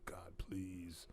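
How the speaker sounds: unvoiced whispered plea; whispered prayer. Praying the dog smells nothing, whispered. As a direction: breathe the prayer under your breath. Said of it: "And I'm like".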